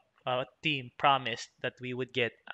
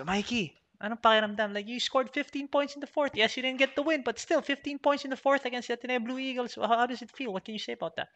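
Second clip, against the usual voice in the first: mock reporter; 9 semitones higher than usual. Imitating reporter's question.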